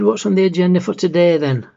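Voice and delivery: loud, high, eager morning greeting; cheery mother. Acted. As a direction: sing out brightly, as if nothing had happened. Mother recovered, cheerily starts the day.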